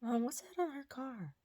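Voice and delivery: put-on high, soft little-kid voice; imitated child. Imitating the eight-year-old son asking his mom, small voice. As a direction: play a small boy quietly asking mom. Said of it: "'cause the kid was like".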